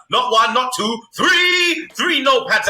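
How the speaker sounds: counting up, ending in a high loud shout on three; building shout. Pretend. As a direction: build it, then blast three.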